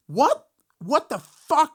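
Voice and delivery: high, loud stunned yelp; as if seeing a ghost walk in; shocked disbelief. Retold or imitated. Roadie suddenly walks in.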